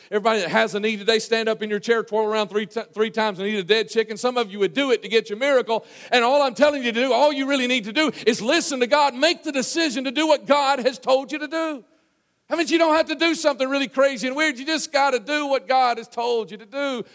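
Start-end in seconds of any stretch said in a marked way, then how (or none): 6.1-17.0 high-pitched, impassioned preaching; insistent pulpit exhortation. Real, not acted. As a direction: drive the message home to the congregation. Preaching at full force.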